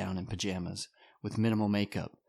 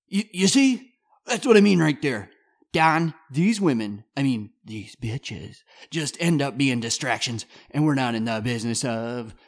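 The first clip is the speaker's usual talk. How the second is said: ranting; 5 semitones higher, 10 dB louder than usual. Acted. Rich ranting at Don.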